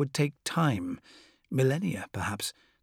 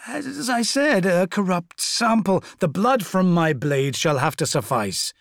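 Fulgrim voice; 6 semitones higher, 5 dB louder than usual. Acted.

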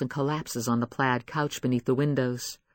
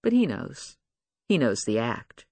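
ordinary delivery

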